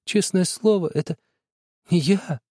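high, halting frightened pleading; stammering. A narrator's reading. Boy stammers his denial to father, frightened. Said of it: "Я отвечал, заикаясь".

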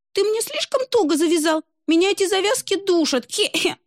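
put-on very high choked little-girl voice; squeaky complaint. Русалка жалуется отцу на тугие завязки, очень высоко. Mermaid complains of tight knot.

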